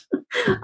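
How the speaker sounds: sudden high giggle; high burst of laughter. Real, not acted. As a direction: break into a helpless laugh. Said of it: "I'm laughing 'cause you're laughing".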